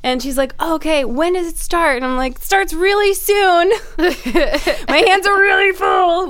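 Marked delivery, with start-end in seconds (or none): 0.6-2.0 imitated girl. Voices the questioning girl. 2.4-6.3 high, slow, forced cheer; gritted smile. Retold or imitated. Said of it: "with the same smile".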